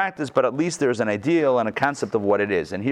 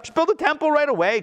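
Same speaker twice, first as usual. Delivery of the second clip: much higher, pushy mock urging; impatient demand; 14 semitones higher than usual. Teacher voicing the eager student who wants a temple now, mock impatient. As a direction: push like someone who cannot wait.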